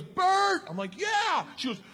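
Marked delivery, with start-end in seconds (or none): 0.0-0.6 high imitated holler; calling out. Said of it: "looks at me and goes". 1.0-1.4 high, hollered answer; yelled reply. Retold or imitated. Hollering back in the plane.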